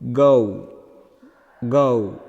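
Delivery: low, drawn-out "go, go"; diphthong in go. Shown as a demonstration. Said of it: "In a words like".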